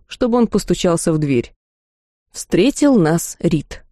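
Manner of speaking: ordinary delivery, nothing marked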